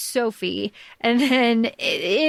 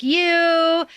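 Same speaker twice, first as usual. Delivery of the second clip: higher, drawn-out taunt; mock defiance; 7 semitones higher, 4 dB louder than usual. Host retelling a character showing up, mock defiance. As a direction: toss it out as a playful retort.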